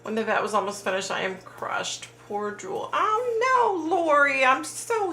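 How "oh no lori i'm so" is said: high dismayed cry of sympathy. Real, not acted. Livestreamer hearing a viewer's cat died, dismayed. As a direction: gasp out sympathy, pitched high.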